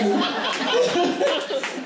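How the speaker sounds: high happy burst of laughter; real laugh. Real, not acted. Laughing at her own story.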